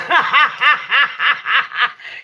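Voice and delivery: real rippling fit of laughter; helpless laugh. Real, not acted. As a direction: crack up mid-sentence. Laughing at listener jumping.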